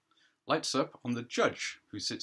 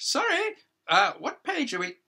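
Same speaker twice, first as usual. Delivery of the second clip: higher, louder voice of a self-important judge; judge addressing a hearing; 8 dB louder than usual. Acted. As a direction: play the judge holding court, a pitch up and projected.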